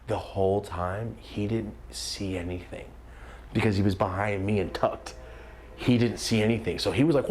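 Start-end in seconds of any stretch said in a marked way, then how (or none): none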